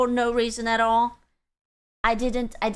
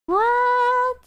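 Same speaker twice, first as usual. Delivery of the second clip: very high, stretched "what"; incredulous; 12 semitones higher than usual. Real, not acted. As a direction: squeak a long, baffled question. Baffled by chat message.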